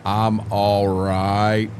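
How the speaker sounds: drawn out slowly, low and dragging; drowsy and slow. Real, not acted. Slow tired reply that Braden says sounds off. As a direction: let the words sag slowly. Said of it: "It, it don't sound it".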